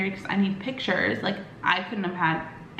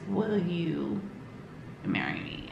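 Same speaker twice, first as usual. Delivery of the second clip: drops to a near whisper, much softer; soft proposal; 3 semitones lower, 8 dB quieter, slower than usual. Retold or imitated. Boyfriend proposing on the glass floor, whispered softly. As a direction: breathe the question out gently.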